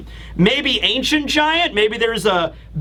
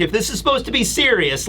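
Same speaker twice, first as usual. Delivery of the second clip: ordinary delivery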